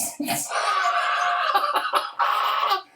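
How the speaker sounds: long, high-pitched laugh. Real, not acted. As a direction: crack up in a high drawn-out laugh. Laughs at bowl cut boss.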